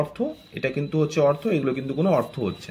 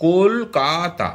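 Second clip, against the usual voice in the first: "Kolkata" very slow, in three separate beats; teacher's drawn-out syllable demo of "Kolkata"; split into syllables; much slower than usual. Shown as a demonstration. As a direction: stretch "Kolkata" out as কল, কা, তা. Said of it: "একে তিনটা ভাগে ভাঙা হয়েছে".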